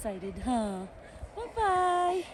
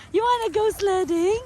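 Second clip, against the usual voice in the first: much higher, eager sing-song question to the dog; high-pitched dog talk; 8 semitones higher than usual. Real, not acted. Asking dog about sledding.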